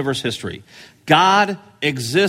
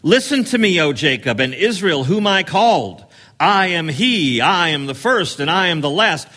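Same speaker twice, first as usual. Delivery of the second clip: booming voice of God; 2 semitones higher than usual. Shown as a demonstration. Preacher voicing God's call to the nations, booming. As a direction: call out as God to his people. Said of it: "look at verse twelve".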